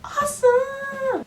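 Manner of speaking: high drawn-out mock Awesome; sarcastic cheer. Real, not acted. Mocking trash talk on the London tube with sarcastic cheer. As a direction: sell it sarcastically.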